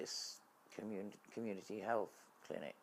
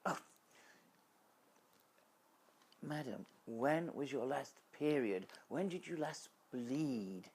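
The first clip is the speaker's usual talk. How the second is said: condescending doctor; 5 semitones higher than usual. Retold or imitated. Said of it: "And she sort of said".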